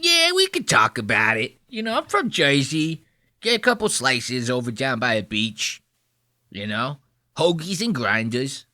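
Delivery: hammed-up New Jersey tough-guy accent; mock a thick Jersey accent. Host mocking Jersey Jerry's voice, exaggerated New Jersey accent. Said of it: "You hear this guy's fucking voice?".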